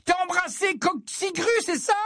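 very high mocking taunt; high-pitched scornful question; jeering shriek. A narrator's reading. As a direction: jeer at your brother, shrieking. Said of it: "Ginny hurla d'un rire moqueur".

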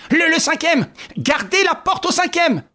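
high, urgent call up the stairwell; shouted order. A narrator's reading. Ganimard calls to his men to guard the fifth floor, high urgent shout. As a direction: bark the floor number to the agents. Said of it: "il cria".

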